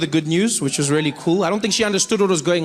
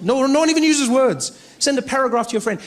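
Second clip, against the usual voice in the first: ordinary talk, nothing marked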